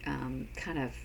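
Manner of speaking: normal talking voice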